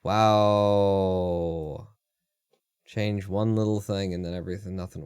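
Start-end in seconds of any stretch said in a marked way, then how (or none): none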